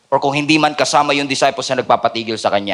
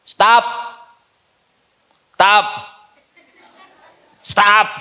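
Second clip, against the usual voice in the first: shouting stop; 3 semitones higher, much slower than usual. Retold or imitated. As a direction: bark it to shut him up. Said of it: "told him to stop".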